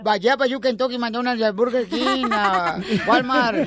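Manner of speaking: broken mock English, stammering brand names; jokey fake English, stumbling and laughing. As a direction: babble fake English like a joke gringo. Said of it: "I don't speak English".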